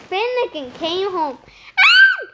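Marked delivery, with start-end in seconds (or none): 1.9-2.2 very high, loud short scream. A narrator's reading. Missus Finnegan finding her home wrecked, scream. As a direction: shriek in shock. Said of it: "she screamed".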